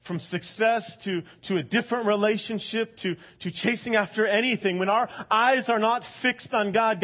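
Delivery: high, loud preacher's plea; fervent sermon build; impassioned preaching. Acted. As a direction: drive the question home from the pulpit. Preaching about idols.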